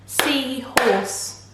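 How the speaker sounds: "Seahorse" slowly segmented as sea, horse; split into syllables. Shown as a demonstration. Teacher prompting children to count, "Seahorse" split in two. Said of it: "how many syllables does that have".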